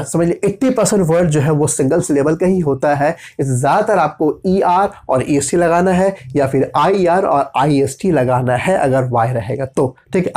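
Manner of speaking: ordinary delivery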